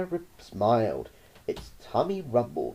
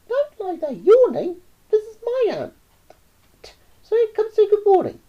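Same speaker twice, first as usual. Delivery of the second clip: put-on much higher, cheeky boy; 8 dB louder than usual. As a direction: brag like a cocky kid. Roy ordering pet.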